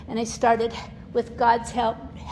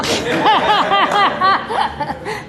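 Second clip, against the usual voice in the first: high laugh; 8 semitones higher, 9 dB louder than usual. Real, not acted. Laughing about the namesake, high laugh. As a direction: burst of high delighted laughter. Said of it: "laughing at the name".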